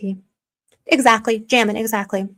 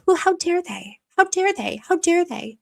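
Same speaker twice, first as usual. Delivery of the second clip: high-pitched repeated how dare they; indignant squeal, much higher; mock outrage; 7 semitones higher than usual. Real, not acted. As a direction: clutch your pearls in disbelief.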